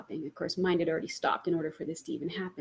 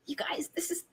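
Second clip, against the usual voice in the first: high voiceless whisper; whispered excited reveal; 7 semitones higher, 8 dB quieter than usual. Retold or imitated. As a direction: reveal it like a secret. Hushed reveal to friends.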